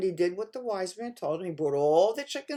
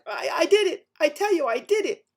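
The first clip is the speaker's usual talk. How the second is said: upset insisting; 7 semitones higher, 7 dB louder than usual. Acted. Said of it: "he was very upset".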